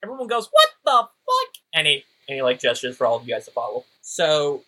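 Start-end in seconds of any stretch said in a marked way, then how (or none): 0.5-1.5 very high, loud outraged yelp; shocked crowd. Acted. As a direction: squawk it in disbelief. Crowd reacts in disbelief.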